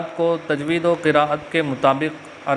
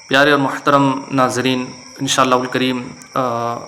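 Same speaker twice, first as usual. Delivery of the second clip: normal talking voice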